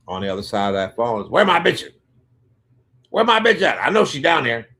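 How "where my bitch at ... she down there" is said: retold raised-voice demand, higher pitched; demanding caller. Angry pimp calling the house for his woman, loud demanding. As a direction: bellow it down the line like an irate caller.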